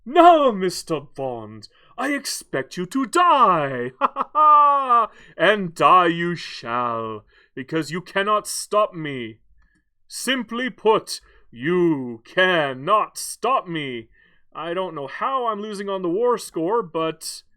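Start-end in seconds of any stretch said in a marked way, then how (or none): 0.1-3.9 booming movie supervillain. Pretend. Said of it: "Doctor No from, uh, from James Bond". 4.0-5.1 loud, high mock cackle; booming hammed-up evil laugh; evil villain laugh. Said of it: "Doctor No from, uh, from James Bond". 5.3-9.4 slow, hammed-up villain declaration. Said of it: "Doctor No from, uh, from James Bond". 10.1-14.1 very slow, loud villain pronouncement. Pretend. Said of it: "Doctor No from, uh, from James Bond".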